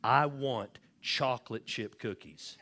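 slow, drawn-out mock cry at ordinary volume; mock scream. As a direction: imitate a boy's demand, stretching every word. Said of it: "he screams it".

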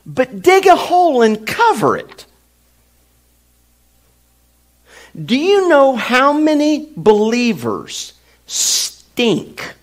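high, loud preacher voice; preaching. Real, not acted. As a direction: proclaim it from the pulpit. Full-force preaching.

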